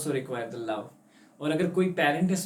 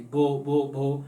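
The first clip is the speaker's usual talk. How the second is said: stammering; much slower than usual. Acted. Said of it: "अपना नाम बताने की कोशिश की".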